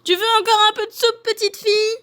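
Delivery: very high, fast squeaky call; shrill cub calling from afar; piercing little bear. A narrator's reading. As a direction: squeal the offer like a tiny bear. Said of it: "crie de sa petite voix perçante".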